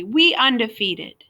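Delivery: slow, self-satisfied boast; smug. A narrator's reading. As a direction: say it smugly. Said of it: "Junior said smugly".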